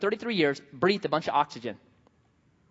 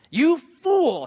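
higher, louder "You fool" flung out; mock harsh rebuke; 8 semitones higher, 6 dB louder than usual. Preacher quoting Paul to doubters, loud mock rebuke. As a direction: bark "fool" like Paul scolding. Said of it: "he says".

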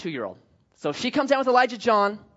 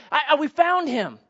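higher, louder relieved searcher calling; imagined voice calling out good news; hoped-for shout; 8 semitones higher, 3 dB louder than usual. Pretend. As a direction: call out like a neighbour who found him. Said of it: "wanting to hear somebody say".

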